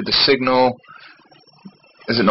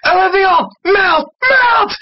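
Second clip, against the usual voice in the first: high French shout; injured officer crying out in alarm; urgent cry; 4 dB louder, much slower than usual. Acted. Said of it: "injured officer cried".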